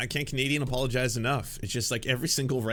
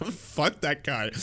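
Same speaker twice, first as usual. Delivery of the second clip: normal talking voice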